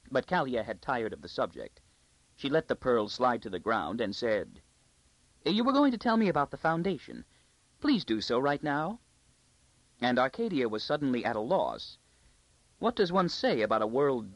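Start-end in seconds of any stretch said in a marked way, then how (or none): 5.4-9.0 high, imperious lady's voice; high court lady. A narrator's reading. Court lady ordering a girl to talk, imperious. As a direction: demand it like a spoiled lady. Kalia demands story.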